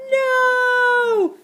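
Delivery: long, high, loud acted wail; horrified cry. A narrator's reading. Narrator cries out as Ned crashes in the moat, drawn-out shout. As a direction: stretch it out in dismay.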